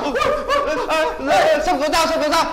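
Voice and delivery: loud, high frantic wailing; panic, calling out in alarm; shocked at finding the girl collapsed, crying out loud in panic; panicked cries. Acted. Finds someone collapsed, panics.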